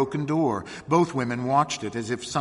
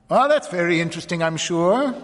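brisk, fast, louder condescension; tolerant; 6 semitones higher, 6 dB louder than usual. A narrator's reading. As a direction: humour them quickly and dismissively. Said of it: "he said tolerantly".

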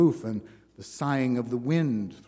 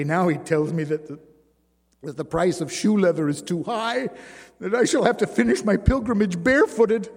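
distressed woman's voice; 6 semitones higher, 7 dB louder than usual. Acted. Jeanne's complaint rising.

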